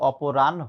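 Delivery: অপরাহ্ন with a long final ho; aw-po-ran... hooo for অপরাহ্ন; correct pronunciation. Shown as a demonstration. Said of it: "এটার উচ্চারণ হচ্ছে".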